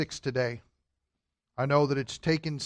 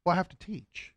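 much softer, gentle parent voice; 11 dB quieter, faster than usual. Real, not acted. Father softly telling his son he must teach, hushed. Soft talk to son in arms.

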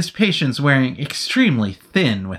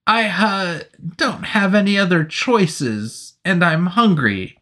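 higher, pleading nervous soldier; 8 semitones higher, slower than usual. Acted. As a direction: beg with no other choice.

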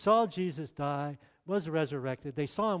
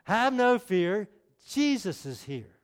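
slow emphatic rhyme, no stretched; preacher recites his rhyme as a better slogan; slower than usual. Shown as a demonstration. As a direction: lean on no, then settle on Jesus is here. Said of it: "this is a lot better than Underdog".

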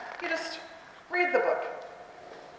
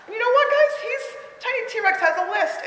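excited hook; 10 semitones higher than usual. Real, not acted. Teacher hooks the class.

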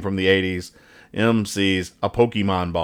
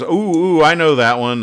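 eager contestant; 6 semitones higher, 5 dB louder than usual. Acted. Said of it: "And John goes".

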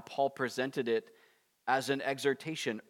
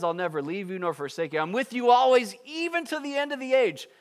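rising promise; 10 semitones higher, 7 dB louder than usual. Acted. Voicing God's promise, rising.

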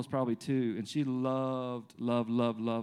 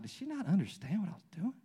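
higher, softer incredulous question; mock bafflement; 6 semitones higher, 6 dB quieter than usual. Dad wondering at his screaming daughter, mock bafflement. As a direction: puzzle over it in mock disbelief.

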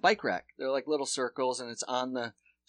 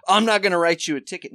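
higher, louder and fast cop line; mock cop; 5 semitones higher, 9 dB louder, faster than usual. Retelling the cop's smug line, higher and louder.

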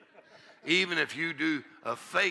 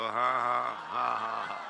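lower, easy model laugh; practice laugh; 6 semitones lower, slower than usual. Shown as a demonstration. Preacher asking the congregation to practice a laugh. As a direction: show them the laugh to copy. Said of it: "Let me hear you practice".

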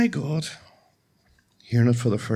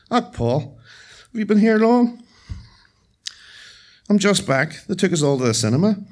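put-on elderly Irish mother; 9 semitones higher, 5 dB louder than usual. Mother greets son.

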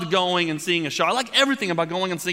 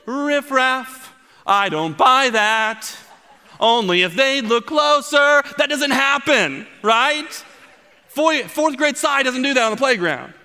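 mock musical number; 8 semitones higher, 5 dB louder, slower than usual.

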